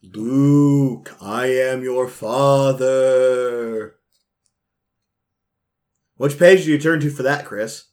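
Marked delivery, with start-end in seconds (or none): 0.0-4.0 long-drawn moaning mock movie line; low moaning tone. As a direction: moan it out very slowly, stretching each word. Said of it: "in a low moaning tone".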